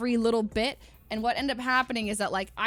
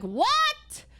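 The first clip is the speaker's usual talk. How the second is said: very high, loud disbelieving what; shocked what; 4 dB louder than usual. Retold or imitated. Told about the armrest, she squeals in loud, high shock. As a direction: yelp it in utter disbelief. Learning there was an armrest.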